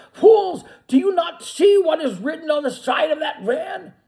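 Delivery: high, loud scornful yell; angry shout. A narrator's reading. Benjamin shouting at the naive animals, angry. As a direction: bark it at them in scorn. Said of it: "shouted Benjamin".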